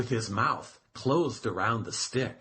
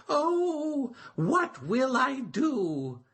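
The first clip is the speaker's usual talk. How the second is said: high, slow, wailing little turtle; a small turtle lamenting, pitched high; crying Turtle; 12 semitones higher, slower than usual. A narrator's reading. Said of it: "cried Turtle".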